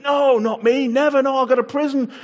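loud, high protest of loyalty; eager vow. Acted. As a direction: insist you'd never leave him. Peter's vow voiced.

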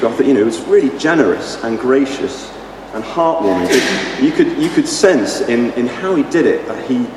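ordinary talk, nothing marked

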